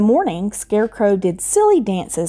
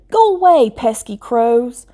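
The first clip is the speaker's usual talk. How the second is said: high, loud shout, slowing down; 5 semitones higher, 3 dB louder than usual. Acted. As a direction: yell to shoo the crows.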